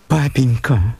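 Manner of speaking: high pleading call to father; plaintive cry. A narrator's reading. Daughter pleads with her father, plaintive cry. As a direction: cry out piteously, begging. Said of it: "закричала она жалобным голосом".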